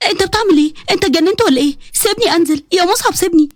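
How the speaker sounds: high-pitched, indignant objection; alarmed protest. Acted. Bride protesting as husband carries her, alarmed. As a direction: demand to be put down, voice lifted.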